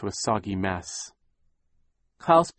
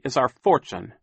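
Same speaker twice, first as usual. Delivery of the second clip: louder, resentful finish; bitter; 4 semitones higher, 7 dB louder than usual. A narrator's reading. Klaus names what Sham wants, bitter. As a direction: land the accusation bitterly. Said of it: "Klaus said bitterly".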